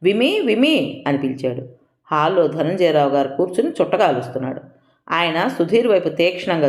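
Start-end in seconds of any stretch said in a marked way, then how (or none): 0.0-1.0 drawn-out urgent call, higher; calling his wife's name urgently from the doorway. A narrator's reading. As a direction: call out for her in a hurry. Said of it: "urgent పని ఉన్నవాళ్ళ".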